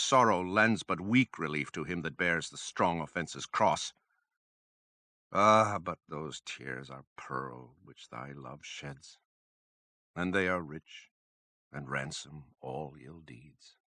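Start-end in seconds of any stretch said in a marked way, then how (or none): 5.3-13.8 a hushed, loving resolution; tender, softer close. Acted. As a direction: lower and slower, softly consoling. Tender turn ending the sonnet.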